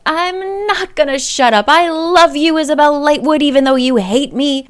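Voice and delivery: loud, high lovesick shouting; drunken yelling. Retold or imitated. Simon shouting his love at Isabel's window, drunk and loud. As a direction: bellow it up at the house, lovesick.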